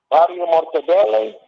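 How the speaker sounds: ordinary talk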